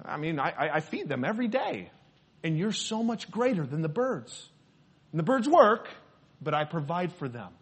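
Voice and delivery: hushed, quick, reassuring voice of God; lightly voiced God, soft and close; God's gentle reassurance. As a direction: softly reassure the worried listener as God. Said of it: "He says".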